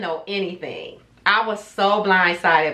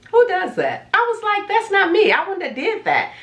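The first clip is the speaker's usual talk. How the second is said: indignant; 10 semitones higher than usual. Retold or imitated. Indignant about being accused.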